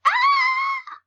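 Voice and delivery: very high, loud shriek; sudden piercing cartoon scream of fright; mock terrified scream. Mouse caught by cat.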